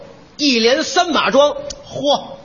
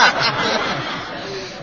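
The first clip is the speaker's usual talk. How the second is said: burst of laughter; 6 dB quieter than usual. Acted. Performer laughs mid-routine.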